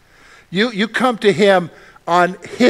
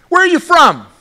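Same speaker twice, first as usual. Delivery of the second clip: higher, louder demand from Pilate; Pilate's demand; 8 semitones higher, 5 dB louder than usual. Acted. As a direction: press the question hard. Pilate's question, acted again.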